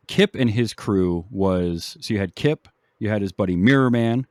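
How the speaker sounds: ordinary talk, nothing marked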